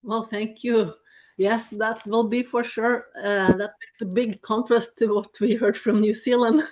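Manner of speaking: ordinary talk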